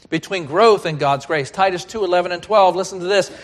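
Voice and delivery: ordinary talk, nothing marked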